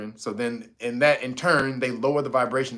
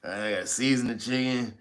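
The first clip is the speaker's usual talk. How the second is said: lower, softer reluctant grumble; lazy grumbling; 5 semitones lower, 5 dB quieter than usual. Retold or imitated. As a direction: moan about having to cook. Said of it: "He's like".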